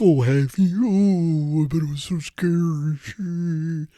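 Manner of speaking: high, loud mocking ghost fading into slow sarcasm; Roast Ghost. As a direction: sarcastically pity the scared listener. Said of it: "Rose Ghost is in there like".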